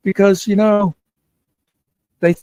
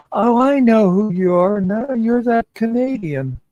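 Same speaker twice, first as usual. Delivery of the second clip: higher-pitched mock voice of the woman; retold recognition; 6 semitones higher than usual. Drunk woman placing the Canadian visitor, mock recognition. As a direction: lightly imitate her, stretching out the end.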